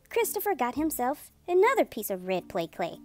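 plain reading voice

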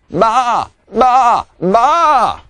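three loud, high sheep bleats; firm bleating. A narrator's reading. Pete the sheep insisting to Sean, firm bleats.

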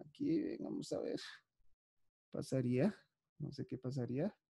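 very soft, low aside; muttering to self. Real, not acted. Teacher puzzling over a Zoom glitch, muttering to herself. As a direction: think aloud under your breath.

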